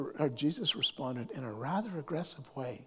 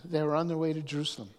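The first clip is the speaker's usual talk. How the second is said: ordinary delivery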